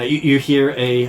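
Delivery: ordinary delivery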